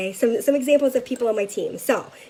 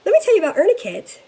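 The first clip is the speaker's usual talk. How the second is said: upbeat pitch; 8 semitones higher, 6 dB louder, faster than usual. Retold or imitated. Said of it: "So I say".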